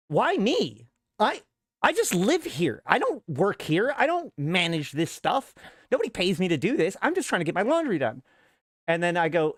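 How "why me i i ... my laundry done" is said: high, swooping retold complaint; acted-out aggrieved pleading; exasperated protest. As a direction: plead your case in a raised, put-upon pitch. Acted protest to support line.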